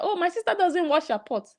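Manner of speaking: high, mocking imitation of Nancy's excuse. Nancy excusing her sister's pots, high mocking imitation. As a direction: mimic her excuse, pitched high and snide. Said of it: "is saying that".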